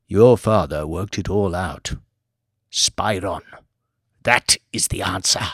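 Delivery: a genocidal aristocrat musing calmly; villainous old lord. Acted. Lord Martin villainous monologue.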